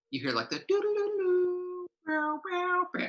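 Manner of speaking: high sung doo-doo western whistle; whistled cowboy tune. Retold or imitated. GM mimicking the standoff music for the players. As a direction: hum the showdown whistle, high and thin. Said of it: "that, like, whistly cowboy thing".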